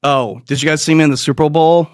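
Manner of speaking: ordinary delivery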